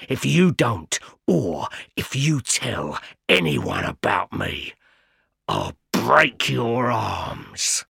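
low, slow menacing threat; fierce convict threat. A narrator's reading. Convict threatening Pip to bring a file and food. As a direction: lean in and threaten him. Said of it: "stared fiercely".

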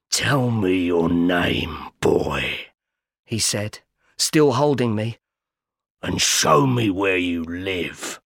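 low, slow convict growl; a rough stranger questioning the boy; menacing convict. A narrator's reading. Convict questioning Pip on the marsh, low menace.

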